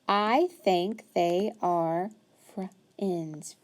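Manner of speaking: usual speaking voice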